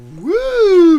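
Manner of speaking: real excited cheer; hype woo. Real, not acted. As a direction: whoop to hype the show. Hype shout for caller.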